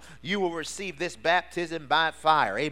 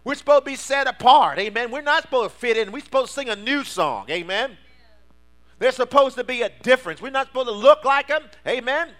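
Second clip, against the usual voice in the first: high, loud climbing sermon cry; preaching at full force; 6 semitones higher, 6 dB louder than usual. Acted. Pastor rousing the church to full force preaching. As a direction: preach it up, higher and louder.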